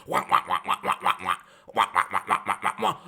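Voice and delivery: low droning wah wah like a grown-up in a cartoon; mocking wah wah. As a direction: drone wah wah low and flat to mock the coach. Mocking Becky Hammon barking.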